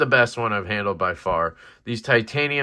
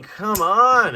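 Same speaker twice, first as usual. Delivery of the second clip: ordinary talk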